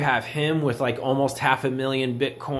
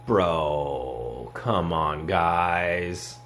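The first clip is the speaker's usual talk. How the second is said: lower, softer groan of disbelief; exasperated; 9 semitones lower, 3 dB quieter, much slower than usual. Real, not acted. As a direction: drag it out, fed up with them. Exasperated at crypto believers.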